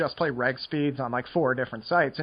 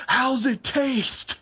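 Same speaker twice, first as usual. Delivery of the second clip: imitating a police officer, higher and slow; mock cop taunt; 9 semitones higher, much slower than usual. Police tackling a smoker at a bus stop, a slow, high mock taunt. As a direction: taunt slowly in a put-on cop voice. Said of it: "tackle you and be like".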